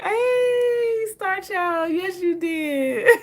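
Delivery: high cheery shout-out; cheery greeting. Real, not acted. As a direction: greet a viewer warmly. Greets a viewer.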